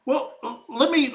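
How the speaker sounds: normal talking voice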